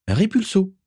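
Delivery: high, ringing spell call; spell incantation. Acted. Morgan casting a spell at an enemy, high incantation. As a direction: cast it out high and sharp.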